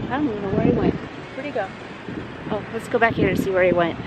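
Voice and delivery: ordinary delivery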